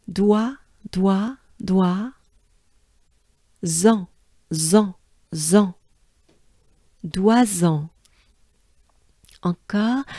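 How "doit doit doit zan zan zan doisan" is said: "doit" and "zan" separately, then joined as "Doisan"; careful French syllables, "doit" then "zan"; broken into syllables. Shown as a demonstration. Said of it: "let's break it down".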